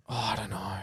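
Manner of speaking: whispered aside; breathy unvoiced murmur. Real, not acted.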